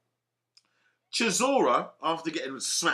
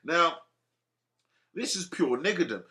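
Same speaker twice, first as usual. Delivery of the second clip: normal talking voice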